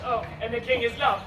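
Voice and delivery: normal talking voice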